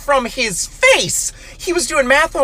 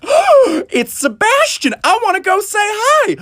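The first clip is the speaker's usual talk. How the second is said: higher, thrilled to spot a friend; excited; 9 semitones higher, slower than usual. Retold or imitated. Tipsy student spotting her raccoon friend, excited. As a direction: squeal at seeing him. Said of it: "I was like".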